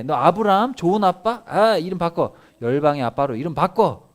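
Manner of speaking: high, loud booming command; commanding God. Acted. God telling Abraham to change his name, loud high command. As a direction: order it like God renaming him.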